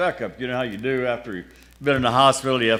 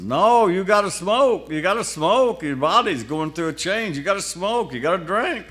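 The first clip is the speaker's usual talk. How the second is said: insistent doctor; 6 semitones higher than usual. Retold or imitated. The doctor urges his patient to keep smoking, insistently. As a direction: push it like a doctor who won't take no.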